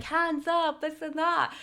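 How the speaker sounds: high, bright mock cheer from the thrill seekers; pumped cousins cheering. Cousins on the ride egging her on, excited cheer. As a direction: imitate hyped friends yelling on a coaster. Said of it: "They were so pumped".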